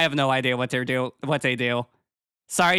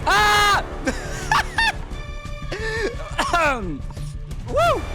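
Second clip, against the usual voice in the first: excited squeal. Real, not acted. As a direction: squeal at the raid. Raid arrives.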